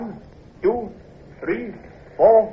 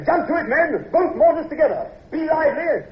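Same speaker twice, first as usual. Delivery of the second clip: high, urgent call to the crew; urging the men; 10 semitones higher than usual. Acted. Officer rallying his mortar crew, urgent and high. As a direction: hurry the men along.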